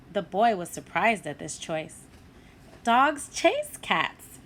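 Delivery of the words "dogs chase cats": put-on boy's voice, slow and deliberate; slow, patient boy; slow, firm boy. A narrator's reading. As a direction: spell it out slowly to the puppy. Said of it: "he told the puppy".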